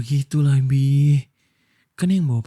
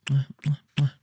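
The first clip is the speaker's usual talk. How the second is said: kiss sounds. Acted. Kissing girlfriend.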